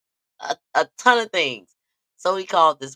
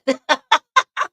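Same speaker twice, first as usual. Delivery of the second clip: shrieking laugh, higher and louder; loud high laughter; 11 semitones higher, 8 dB louder than usual. Real, not acted. Laughing at his claim that they are together. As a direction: let a big laugh burst out.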